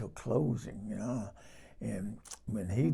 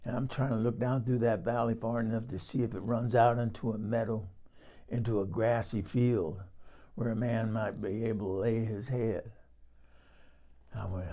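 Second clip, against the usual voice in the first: ordinary delivery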